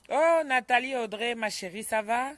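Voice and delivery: loud, high, rising hello; loud greeting. Real, not acted. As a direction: sing out the name in delight. Greeting a viewer by name.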